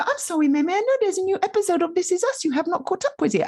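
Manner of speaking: put-on high, prim little-girl voice; hammed-up dutiful child; high mock-sweet apology; mock polite toddler.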